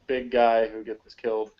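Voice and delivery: ordinary talk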